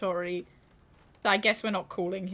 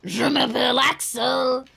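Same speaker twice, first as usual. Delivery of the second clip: loud, hammed-up French accent; mock French charm, laughing through it; 3 semitones higher, 7 dB louder than usual. Voicing Axel the train in a hammed-up French accent. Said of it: "he'll have a good accent as well".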